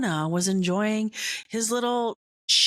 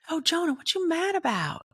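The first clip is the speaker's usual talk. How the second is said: high, light teasing question; lightly voiced God gently teasing; God's voice; 9 semitones higher than usual. God teasing grouchy Jonah about his anger. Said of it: "and God's like".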